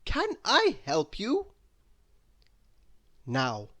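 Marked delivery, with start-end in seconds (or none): 0.0-1.5 lightly voiced polite hotel manager, higher; a touch of a courteous clerk greeting a guest. A narrator's reading.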